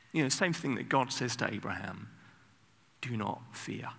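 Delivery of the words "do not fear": soft and gentle, like a father soothing; tender fatherly. Shown as a demonstration. Preacher voicing God tenderly to frightened people, soft and fatherly. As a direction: hush the fear out of them kindly. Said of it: "Sounds like a very tenderly, fatherly response".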